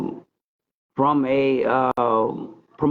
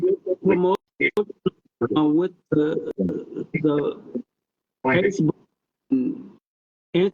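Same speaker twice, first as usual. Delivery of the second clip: phone held away; 2 semitones higher, slower than usual. Real, not acted. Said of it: "I'm cutting in and out of".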